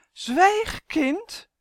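high, loud order to be silent; sharp rebuke. Acted. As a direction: silence the child, shocked and commanding. Doll silences child in story. (bestraffend, hoog en luid)

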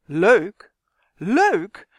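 loud, incredulous outburst; exploding indignantly. A narrator's reading. Justin blows up at Kwark's remark, loudly indignant. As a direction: throw the word back, outraged. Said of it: "Justin ontplofte zowat".

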